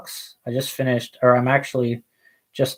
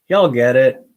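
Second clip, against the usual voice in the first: loud jokey y'all voice; put-on voice; 6 dB louder than usual. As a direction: punch it like a hype man. Voiced repeat of the phrase.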